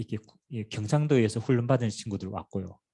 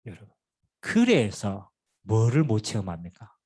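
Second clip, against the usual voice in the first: ordinary delivery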